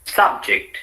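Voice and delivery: "subject" as SUB-ject; full vowel in SUB, weak JECT in subject; stress on first syllable. Shown as a demonstration. Teacher models the noun for repetition, subject stressed on SUB. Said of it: "said in two different ways".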